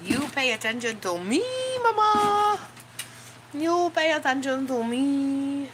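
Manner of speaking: high, loud whiny dog voice; voicing the dog. Dog begging his mama for attention, high whiny voice. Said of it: "He's like".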